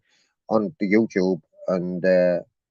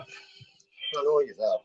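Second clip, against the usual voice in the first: slow drawn-out taunt; 8 dB quieter, much slower than usual. Acted. Caller taunting booking dot com on camera, slow drawn-out tease. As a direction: stretch each word out, very slow and teasing.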